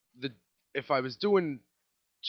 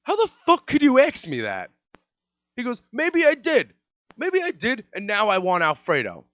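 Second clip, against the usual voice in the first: higher, louder furious yell; angry customer yelling; 8 semitones higher, 7 dB louder than usual. Retold or imitated. Customer yelling at the waiter, hammed-up outrage. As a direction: blow up at the waiter. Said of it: "Guy looks at me and he goes".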